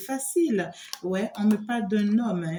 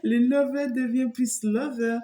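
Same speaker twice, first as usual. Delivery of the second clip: higher, laughing playful repeat; 4 semitones higher than usual. Real, not acted. Reader repeats her pun to viewers, higher and laughing. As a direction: say it again, still amused by the pun.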